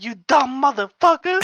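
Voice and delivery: high, imitated snarl; mock angry insult. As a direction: spit the insult, pitched far up. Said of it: "she was like".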